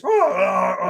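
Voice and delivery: imitated Wookiee roar; Chewbacca roar. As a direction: groan out a drawn-out Wookiee roar. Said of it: "And Chewbacca goes".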